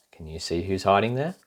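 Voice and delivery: ordinary delivery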